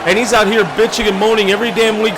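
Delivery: usual speaking voice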